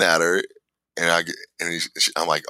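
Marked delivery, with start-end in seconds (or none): none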